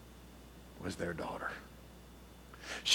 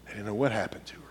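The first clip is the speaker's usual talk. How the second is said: hushed whisper; 3 semitones lower, 8 dB quieter, faster than usual. Real, not acted. Sad turn in the story.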